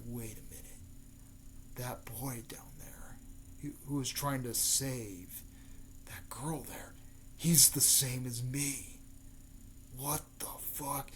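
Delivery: hushed, half-whispered realization; stunned murmur. Retold or imitated. Gaara watching from the stands, stunned murmur to himself.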